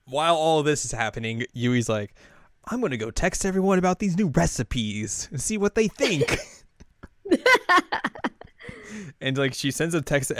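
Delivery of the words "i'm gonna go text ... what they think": high, chirpy imitation of an excited girl; mock eager announcement. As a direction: announce a silly plan with high-pitched glee. Yui announces her recipe plan.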